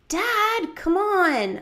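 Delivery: put-on high, loud nagging kid; impatient urging. A narrator's reading. Child urges dad out to the party, impatient plea. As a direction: push Dad along, pitched right up.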